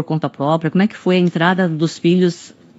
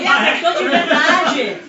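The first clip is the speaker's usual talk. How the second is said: laughing; 9 semitones higher than usual. Real, not acted. Laughs at the stage mishap.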